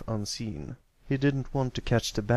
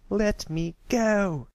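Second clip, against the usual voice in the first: put-on high, loud, slow shout of a furious young filly; young filly shouting at her friend to let her go; angry filly; 10 semitones higher, 4 dB louder, much slower than usual. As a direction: yell it while struggling free of a hold. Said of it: "Scootaloo scowled as she tried to free herself".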